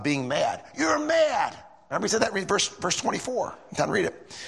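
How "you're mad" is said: much higher sudden shout; angry accusation. Acted. Festus shouting at Paul, angry accusing shout. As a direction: bark it out like a furious governor. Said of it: "Festus seemed angry".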